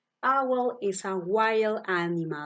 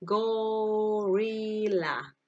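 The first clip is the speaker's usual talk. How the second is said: Gorilla drawn out slowly, every syllable stretched go-ri-lla; slow model word; much slower than usual. Shown as a demonstration. Teacher slowly modeling Gorilla for the class to repeat. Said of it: "How do you say?".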